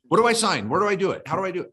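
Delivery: mock eager employee; eager rush. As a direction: play the overeager buyer who wants to sign now. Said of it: "You know, like".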